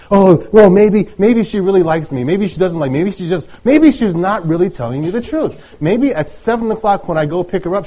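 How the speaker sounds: high-pitched fretting inner voice; anxious doubting. Acted. Teenager fretting to himself before a date, acted anxious doubting. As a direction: act out a nervous dater spiralling into doubt. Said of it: "you're having all these doubts".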